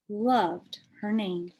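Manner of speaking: plain reading voice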